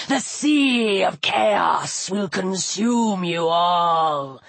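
deep, slow voice of doom; evil serpent god. A narrator's reading. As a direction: pronounce doom in long, low words. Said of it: "its carved mouth twisted into a smile".

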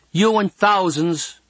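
put-on high, slow girlish put-down; teasing girl. A narrator's reading. Girl unimpressed by boy's hazel eyes, teasing. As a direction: draw out the tease in a girl's voice.

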